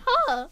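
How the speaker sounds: high squeaky imitated huh; mock cracked huh. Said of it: "What is with the voice cracks today?".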